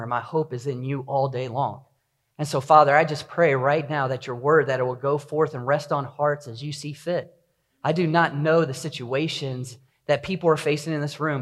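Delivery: soft, low reverent prayer. Acted. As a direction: pray quietly and humbly. Opening prayer.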